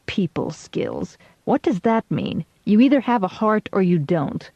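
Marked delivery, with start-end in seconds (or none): 0.0-1.2 "people skills" said slowly and softly with distaste; sour, slow aside; distasteful quoting. A narrator's reading. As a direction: drop "people skills" quietly, like a dirty phrase. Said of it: "I hate that expression".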